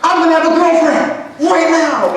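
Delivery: high, drawn-out excited yell; squealing with excitement, words stretched out; ecstatic. Retold or imitated. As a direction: re-enact a kid announcing big news to mom. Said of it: "never been so excited in my life".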